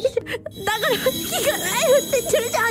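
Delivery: high teary protest, mock crying; 半泣きの高い声で; tearful whine. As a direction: whimper it like you're about to cry again. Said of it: "船長がもう一回泣く".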